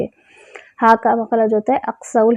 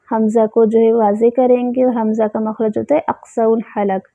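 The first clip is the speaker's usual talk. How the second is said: usual speaking voice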